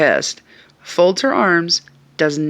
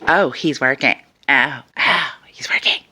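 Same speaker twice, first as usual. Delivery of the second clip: mocking sneer. Mocking woman's retort.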